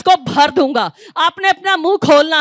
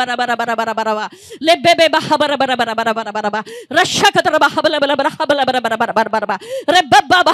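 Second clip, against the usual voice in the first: rapid babbling syllables, pitch sliding down each run; speaking in tongues; 4 semitones lower than usual. Real, not acted. Preacher leading the congregation in tongues, ecstatic babble.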